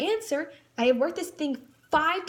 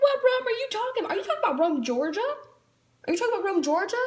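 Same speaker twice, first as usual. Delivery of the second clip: higher, mocking incredulous questions; sarcastic disbelief; 6 semitones higher, much faster than usual. Student mocks the history teacher's wrong Rome, incredulous sarcasm. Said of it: "I'm just like".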